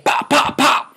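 voiceless pop pop pop gunshot sounds; unvoiced gunshot imitation; whispered gun pops. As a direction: breathe out the pops like muffled shots.

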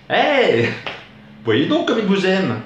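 high-pitched fiancée calling attention; put-on high voice of a delighted fiancée; amused fiancée. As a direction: exclaim in a high, teasing lady voice. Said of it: "me dit ma future en riant".

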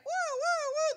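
high, loud wailing woo of a store alarm; alarm imitation. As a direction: mimic a siren going off. Alarm goes off in Walmart.

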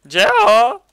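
high, loud calling out. Real, not acted. Calling a friend who won't answer, loud call. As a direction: call out to get his attention.

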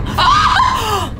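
high laughing shriek; laughing scream. Real, not acted. Jump scare in VR.

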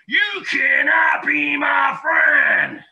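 high, loud, snappy squirrel shout; bossy squirrel yelling down at the hedgehog; sharp, snappy squirrel. A narrator's reading. Squirrel taunting Harry, a sharp high shout. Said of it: "replied Sammy in a sharp, snappy voice".

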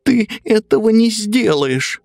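high defiant piglet voice. Acted. Piglet defies the wolf.

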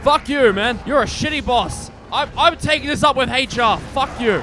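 loud, high play-acted outrage; mock angry rant. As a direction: shout at the boss in mock fury. Mock quarrel with his boss.

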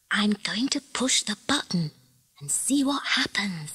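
hushed, secretive whisper. A narrator's reading. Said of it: "she whispered".

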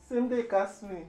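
ordinary talk

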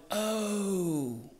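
long high falling "oh"; mock reaction. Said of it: "they all went".

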